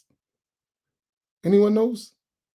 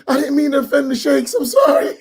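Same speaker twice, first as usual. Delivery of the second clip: frantic apology; 6 semitones higher than usual. Retold or imitated. Host begging the people's pardon, high squealing apology. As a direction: cry out your sorry to everyone. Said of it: "He go to the people".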